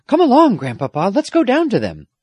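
put-on high, loud girlish eagerness; eager urging. A narrator's reading. Girl urging grandfather down to the shore, eager. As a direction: hurry him along excitedly.

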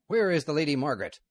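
loud, high fast demand. Acted. Outlaw leader demanding the girl, loud and fast. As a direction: bark the question at her.

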